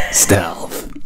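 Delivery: hissed stealthy whisper; snake voice whisper. Shown as a demonstration. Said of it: "check this out".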